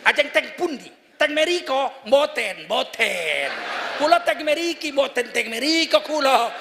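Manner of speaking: Javanese in a comic Batak accent; hammed-up Batak accent on polite Javanese. Shown as a demonstration. Preacher acting a Javanese street exchange for laughs, Batak accent. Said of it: "Bahasa Jawa pakai logat Batak coba".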